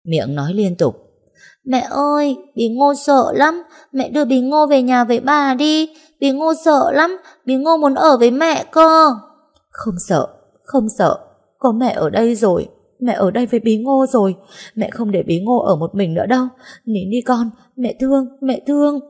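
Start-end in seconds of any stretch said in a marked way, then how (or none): 1.6-9.1 put-on much higher, pleading small child's voice; a scared toddler begging to go home; frightened little girl. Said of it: "Con bé chắc phải rất sợ hãi". 9.7-19.0 put-on higher, tender hushing as she rocks her child; calm a crying little girl; soothing mother. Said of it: "Tôi dỗ con là dỗ vậy thôi".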